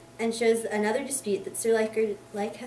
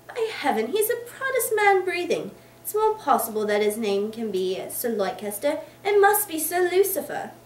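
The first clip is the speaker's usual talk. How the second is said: higher and louder, mocking; indignant mocking bluster; scornful outburst; 6 semitones higher, 5 dB louder than usual. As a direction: spit out the insult with scorn. Boythorn mocks Sir Leicester.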